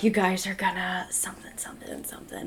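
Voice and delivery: hushed, whispery ghostly mumble; imitated ghost voice. Retelling a voice caught on the recorder, imitated in a whisper. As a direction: whisper it, trailing into near-silence. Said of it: "And it sounded like".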